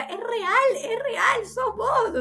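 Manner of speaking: a high, tearful cry, pitched well up; a weeping father, breaking out in disbelief; the father crying to the medium, high and tearful; retold tearful cry. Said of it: "empezó a llorar".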